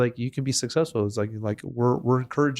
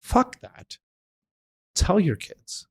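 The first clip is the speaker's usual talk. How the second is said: emphatic outburst; 8 semitones higher, much slower than usual. Retold or imitated. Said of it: "I'm like".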